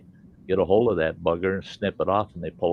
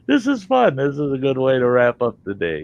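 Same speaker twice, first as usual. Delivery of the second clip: amused doctor; 5 semitones higher, 4 dB louder than usual. Retold or imitated. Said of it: "he's laughing. He goes".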